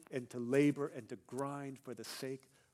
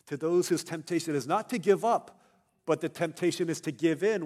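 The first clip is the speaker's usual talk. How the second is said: loud, raised pulpit contrast; emphatic preaching; 4 semitones higher, 12 dB louder than usual. Real, not acted. Preacher addressing the tempted, emphatic. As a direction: drive the point home at volume.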